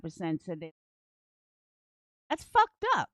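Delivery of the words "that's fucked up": high mock voice. Mock outrage at poll result.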